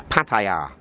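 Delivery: PATTAYA as pat-eye-YAH, long open final syllable; pronunciation one. Shown as a demonstration. Vlogger showing the Thai-like way, Pattaya as pat-eye-YAH. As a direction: say Pattaya with TIE in the middle and a drawn-out YAH. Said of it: "this is pronunciation one".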